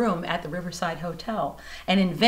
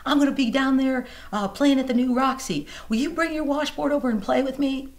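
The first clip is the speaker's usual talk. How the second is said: higher, louder eager friend; a hint of an excited pal calling; friend on the phone; 7 semitones higher, 3 dB louder than usual. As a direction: Nashville friend inviting her to play, lightly voiced. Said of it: "he goes".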